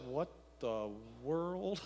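low, slow baffled mutter; puzzled muttering. Retold or imitated. Jehoshaphat mutters, baffled by prophets.

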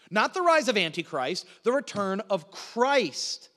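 the return of Christ slowed right down, weighed; stress on return of Christ. Shown as a demonstration. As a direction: set Christ against Antichrist. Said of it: "contrast Antichrist and Christ".

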